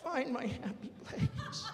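slow laughing plea to himself; laughing mantra. Pretend. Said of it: "mantra repeated, higher, laughing".

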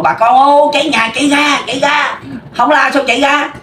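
high-pitched urgent calling out; mock fire alarm cry. Villager alerts neighbors to a house fire, high urgent cry. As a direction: call to the neighbors that the house is burning.

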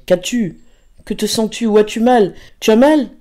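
increasingly urgent, high-pitched worry; mounting alarm. A narrator's reading. Pauline questioning her ailing beloved, mounting alarm. Pauline panics as Raphaël pales.

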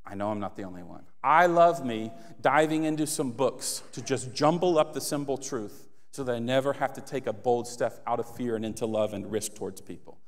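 ordinary delivery

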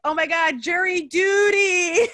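loud, high mock shriek, drawn out at the end; shrieked reaction. Sister reacting to a pants accident, shrieking. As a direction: build to a slow, high shout. Sister's shocked reply retold, shouted.

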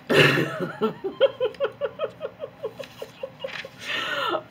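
high squealing giddy laugh. Real, not acted. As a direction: burst into a high delighted laugh. Giddy over notebook smell.

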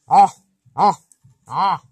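Ah as a short nasal grunt. Shown as a demonstration. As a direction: grunt Ah through the nose. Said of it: "just try to say the, the sound".

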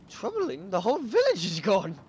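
high, loud, upset villager; distressed cry. Acted.

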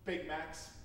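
ordinary talk

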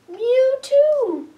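very high, loud little cat voice; high mewing kitty voice. Acted. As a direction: squeak it like a tiny kitten. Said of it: "And Kitty said".

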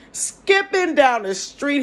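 high, loud, slow and gleeful; gleeful skipping. Retold or imitated. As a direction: sing it out like you're skipping. Recalls skipping with lawyer.

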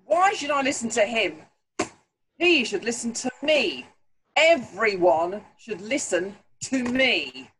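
loud, indignant royal rant; arrogant angry king. Acted. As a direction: sneer it like a proud tyrant. Arrogant king rejects the warning.

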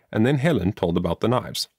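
ordinary delivery, nothing marked

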